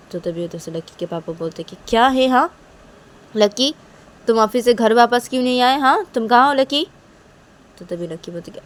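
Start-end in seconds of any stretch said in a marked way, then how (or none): 1.9-6.9 loud, higher stern questioning; demanding. Acted. Father on phone demanding where Lucky is. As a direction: demand an answer sharply.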